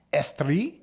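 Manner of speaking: Estrie C M A said slowly in a put-on French accent; slow, drawn-out French-accented Estrie; mock French accent. Putting on a French accent while reading Quebec regions. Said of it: "I don't know why I said it like that".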